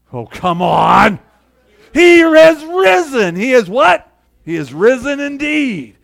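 loud, slow, soaring preacher's shout; rousing call and response. Real, not acted. Preacher rousing a sleepy congregation to answer, loud slow shout. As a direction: pull the answer out of the crowd at full force.

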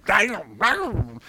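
put-on high, loud child parroting; mock child on repeat; kid repeating a word. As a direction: gleefully echo like a little kid. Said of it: "they just say it over and over".

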